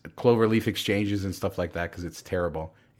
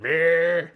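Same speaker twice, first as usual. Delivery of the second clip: car noise; 8 semitones higher than usual. Retold or imitated. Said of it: "So it's like".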